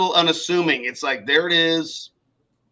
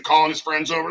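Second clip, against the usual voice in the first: normal talking voice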